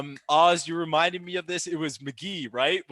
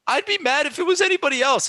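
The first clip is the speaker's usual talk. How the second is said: high, loud indignant protest; outraged rant; 10 semitones higher, 6 dB louder, faster than usual. Real, not acted. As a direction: argue the referee's call in real disbelief. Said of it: "Sorry, my little rant".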